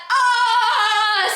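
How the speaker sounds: long, high "ohhh"; drawn-out cry of scandalised shock; shocked wail. Retold or imitated. Storyteller reacting to Jacob's admission, shocked wail.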